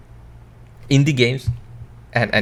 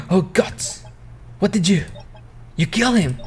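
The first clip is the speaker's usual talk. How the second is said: gasping, much higher in horror; shocked gasp; 7 semitones higher than usual. Acted. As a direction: gasp and choke out the words in horror. Butler shocked by the murder.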